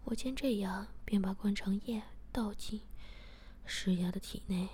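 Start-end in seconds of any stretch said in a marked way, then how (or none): none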